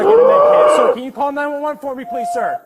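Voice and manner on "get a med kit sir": loud urgent bark, high pitched; man down shout. Real, not acted. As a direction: yell the order across the scene. Officer repeats order, urgent.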